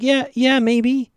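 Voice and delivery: very high, evasive imitation; mock noncommittal. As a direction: dodge the invitation in a high put-on voice. Arya's noncommittal answer, retold.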